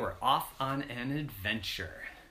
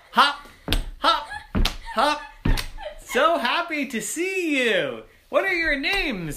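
high, loud, bouncy greeting; excited kangaroo; 8 dB louder than usual. Acted. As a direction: bounce the hops, then gush hello. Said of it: "said the kangaroo".

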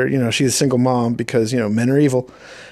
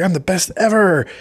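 mock heroine brag; 7 semitones higher than usual. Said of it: "And she's like".

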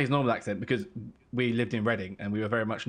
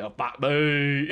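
slow, drawn-out Aussie exclamation; mock Australian twang; 3 semitones higher, 4 dB louder, much slower than usual. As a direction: stretch it in an Australian accent. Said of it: "that's just a gag".

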